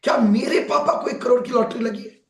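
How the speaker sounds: can't believe his own eyes; shocked disbelief. Acted. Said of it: "shocked हो जाता है".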